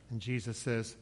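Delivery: ordinary delivery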